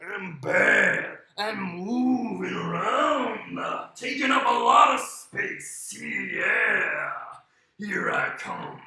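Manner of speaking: slow, menacing villain pig; hulking bully pig on the hunt; big bad pig. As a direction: take up space, slowly and heavily. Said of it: "if I'm a pig, and I'm big, and I'm bad".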